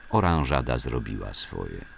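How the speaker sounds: ordinary delivery, nothing marked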